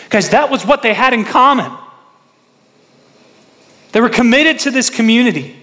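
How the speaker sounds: high, loud, fervent sermon delivery; preaching at full force. Acted. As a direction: preach it out to the congregation.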